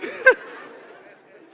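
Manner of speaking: loud airy laughter; loud voiceless laughing; breathy laugh. Real, not acted. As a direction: laugh without voice. Continues laughing.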